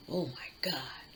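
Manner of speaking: breathless whispered gasp of dread; frightened whisper. Retold or imitated. Scared girl under her father's threat, frightened whisper. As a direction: whisper it like you can barely breathe.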